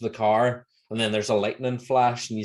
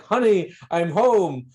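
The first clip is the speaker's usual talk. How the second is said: high, louder hammed-up Homer; imitating Homer; 10 semitones higher, 6 dB louder than usual. Homer faking a heart attack at Flanders' door, hammed up. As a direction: fake heart attack, overplayed. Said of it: "he's like".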